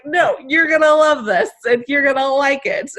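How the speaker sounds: high, loud teasing insistence; laughing-edged insistence on a hug; playful teasing. Retold or imitated. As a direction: tease him, loud and bright. Teasing coach with affection.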